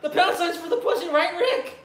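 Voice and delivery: put-on high, loud, stammering anxious teen; Morty impression. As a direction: play the nervous squeaky kid, laughing through it. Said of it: "You do a horrible Morty impression".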